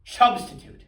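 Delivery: high, loud exclamation; triumphant blurted answer. Acted. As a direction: announce it loudly like a big reveal. Blurts out his joke title.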